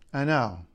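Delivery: "I know" said short with a falling tune; varied intonation. Shown as a demonstration. Linguist showing how intonation changes meaning, falling "I know". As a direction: let "I know" drop at the end, briefly. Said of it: "your intonation can make a huge difference".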